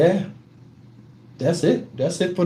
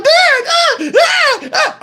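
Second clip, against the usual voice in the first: high-pitched mock screaming for dad; imitating a panicked little cousin; child's panicked scream; much slower than usual. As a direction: yelp in short bursts like a scared kid.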